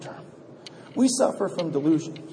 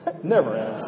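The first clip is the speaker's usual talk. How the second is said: chuckling; 7 semitones lower than usual. Retold or imitated. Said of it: "He laughs".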